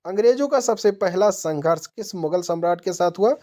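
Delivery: ordinary talk, nothing marked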